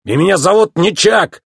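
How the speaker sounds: high, annoyed protest; exasperated outburst; irritated cry. A narrator's reading. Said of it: "раздражённо крикнул механик".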